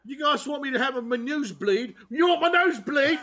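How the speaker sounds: high hammed-up British teen; mock British kid actress. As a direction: taunt the show creators in a bratty accent. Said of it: "I'm Millie Bobby Brown".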